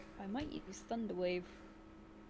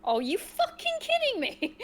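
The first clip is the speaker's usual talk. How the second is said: exasperated outburst; 8 dB louder than usual. Real, not acted. Game menu refuses level.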